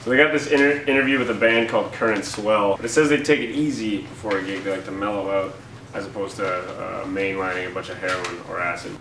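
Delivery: usual speaking voice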